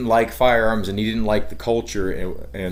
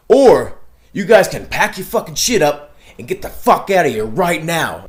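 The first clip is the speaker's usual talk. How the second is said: high, louder put-on tough-guy voice; mock gangster sheriff; 6 semitones higher, 5 dB louder than usual. Imitating the sheriff telling them to leave, high hammed-up tough voice. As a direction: ham up the sheriff ordering them off. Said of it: "He's like".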